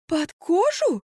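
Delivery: high shocked gasp. A narrator's reading. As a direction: gasp the question out. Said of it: "ахнула Марфа".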